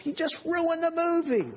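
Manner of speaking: high-pitched comic protest; playful outburst, pitch leaping up; mock exasperation. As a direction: complain in exaggerated disbelief. Preacher mock-protests at film cliché.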